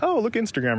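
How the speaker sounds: mock distracted delight. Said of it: "you're like".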